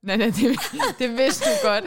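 high, laughing it's-a-joke protest. Real, not acted. Teasing denial, laughing.

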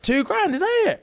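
high, squeaky fake outrage at the price; mock shock. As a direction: act scandalised, pitch way up.

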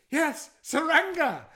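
high, loud, drawn-out agreement; emphatic confirmation. Retold or imitated. Stung man confirming the villager's guess, acted high emphatic excitement. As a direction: cry out at being understood at last. Relieved at finally being understood.

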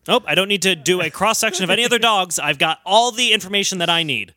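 ordinary talk, nothing marked

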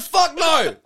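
high, loud, appalled comeback; mock outrage with laughter creeping in; indignant refusal. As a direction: reject it outright, voice jumping up. Retold indignant refusal to waiter.

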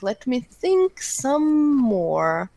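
slow, drawn-out musing. Real, not acted. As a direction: stretch each word out slowly while thinking. Pondering his guess aloud.

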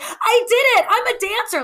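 forced happy. Retold or imitated. Contestant after her first dance, giddy cheer. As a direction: play it beaming and overjoyed. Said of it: "I was trying to be, like, happy".

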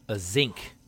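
zinc for sink, starting with a z; Baltimore pronunciation. Retold or imitated. Answering where water comes out, Baltimore-style zinc. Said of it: "That's more of a Baltimore thing".

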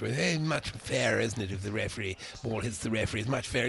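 lazy plummy upper-class English impression; mocking posh voice. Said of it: "you know, he's posh".

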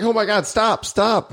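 high-pitched comic begging; mock desperate plea. Acting out too much friction afterwards, mock desperate plea. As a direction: beg for it to stop, hammed up. Acts out overstimulated plea to stop.

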